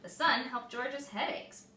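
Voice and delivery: plain reading voice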